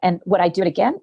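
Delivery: ordinary talk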